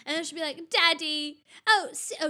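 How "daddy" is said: put-on louder British-accented child's call; imitated British accent. Daughter calling her dad like Peppa, imitated British accent. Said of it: "use a British accent".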